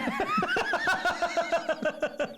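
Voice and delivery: helpless laughter; high laughter. Real, not acted. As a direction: crack up into a howl. Big laugh.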